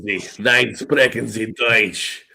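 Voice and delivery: put-on low, drawn-out German accent; mock German accent. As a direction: play a stern German, slow and low. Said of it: "is that how Germans really talk".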